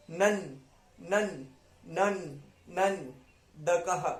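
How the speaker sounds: "नन" repeated as a separate slow syllable, then "दकः"; syllable-by-syllable demo. Shown as a demonstration. Teacher drilling pronunciation, "नन" and "दकः" broken apart. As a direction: drill "नन" with a held final n, pause, add "दकः". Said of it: "pronunciation के लिए अन".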